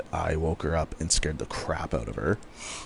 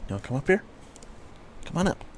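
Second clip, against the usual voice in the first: high sing-song coaxing for the dog; 10 semitones higher, 4 dB quieter, much faster than usual. Real, not acted. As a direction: call the dog up sweetly, much higher. Coaxing the dog onto her.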